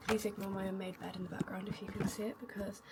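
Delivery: normal talking voice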